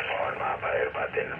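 ordinary talk

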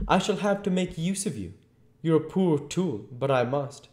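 high, loud, scornful master; high-pitched, forceful contempt; domineering invisible Voice. A narrator's reading. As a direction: talk down to your reluctant helper.

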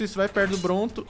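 normal talking voice

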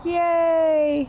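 high, loud yay; cheering. Acted. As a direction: shout for joy. Cheer at the end.

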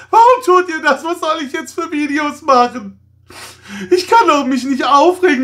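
sarcastic over-the-top tantrum; mock outrage. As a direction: fake hysterical shouting at Lego. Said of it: "meine kleine, ironische, sarkastische Nummer".

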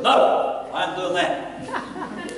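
high, slowed-down mock refusal; playfully acted balking; stubborn refusal. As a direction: dig your heels in, drawing it out.

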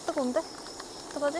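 hot bite still in the mouth; words around scalding food; mouth full of hot food. Real, not acted. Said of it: "아, 뜨거".

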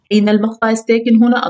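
ordinary talk

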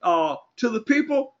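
ordinary delivery

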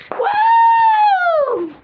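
very high, loud held "woo"; long cheering whoop. Acted. Friend cheers the new couple, high whoop. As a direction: let out a piercing whoop.

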